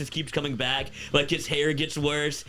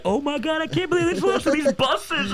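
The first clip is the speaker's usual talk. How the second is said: much higher, louder imitation of a laughing fan; mock gleeful disbelief; 14 semitones higher, 5 dB louder than usual. Retelling recruits watching Kansas beat Texas, mock gleeful disbelief. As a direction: mimic amused disbelief in a high-pitched cry. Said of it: "laughing on the stream".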